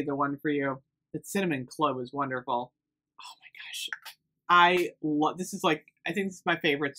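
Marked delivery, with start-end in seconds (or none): 3.2-3.9 real hushed whisper of amazement; barely-there, awestruck whisper; awed whisper. Real, not acted. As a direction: whisper it, overwhelmed by the scent. Awed reaction to scent.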